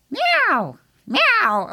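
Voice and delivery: high mock cat meows; cat meowing. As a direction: whine like the cat waiting in the kitchen. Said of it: "he goes and sits in the kitchen".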